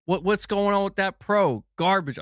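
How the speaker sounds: high, teasing rant; mock outrage. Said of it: "I'm busting your balls".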